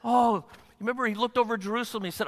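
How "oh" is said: high, pained "oh"; brokenhearted sigh. Acted. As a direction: lament with an aching cry. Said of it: "There's a brokenheartedness that says".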